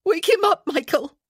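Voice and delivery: loud, very high frantic plea; desperate cry. A narrator's reading. Wife begging friend to wake her husband, crying out desperately. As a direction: cry out in panic. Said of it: "she cried".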